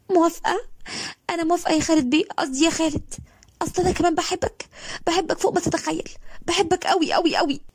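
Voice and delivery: high, breathless, overjoyed yes; a girl crying with happiness accepting his proposal; tearful joyful girl. A narrator's reading. (صوت بنت فرحانة وبتعيط)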